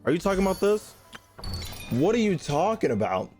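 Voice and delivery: ordinary talk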